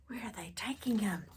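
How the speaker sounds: quick hushed worried whisper. Real, not acted. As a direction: whisper it as if scared for him. Worried aside to listeners.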